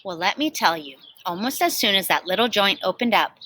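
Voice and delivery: plain reading voice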